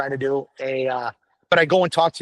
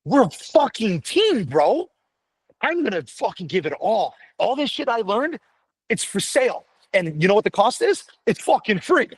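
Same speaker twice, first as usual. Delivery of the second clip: higher and intense, hammering the point; fired up; 6 semitones higher than usual. Real, not acted. Firefighter to fellow firemen, fired-up rant. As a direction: preach it like a pep talk.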